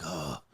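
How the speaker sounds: low, very soft mock groan; exhausted husband's quiet "ugh"; weary groan. Worn-out husband dodging his wife's dinner question, weary groan.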